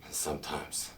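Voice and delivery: menacing whisper. Acted. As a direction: breathe it out with cruel relish. Villain savoring cruelty.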